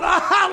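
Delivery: imitated loud high laugh; mock guffaw, loud and high; Uecker. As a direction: burst out in someone else's laugh. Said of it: "like, Euchre's laughing".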